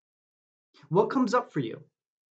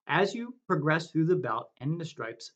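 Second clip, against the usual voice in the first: ordinary delivery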